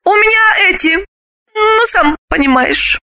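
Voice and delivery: hint awkwardly, high voice; high, coy female evasion; evasive hint. Acted. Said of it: "уклончиво пояснила".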